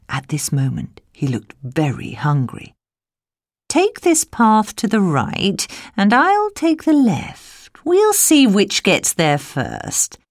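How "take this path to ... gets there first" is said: slow, high, louder sly challenge; cunning wolf voice. A narrator's reading. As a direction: tease her with a sly wolf's dare. Wolf proposes race, slow.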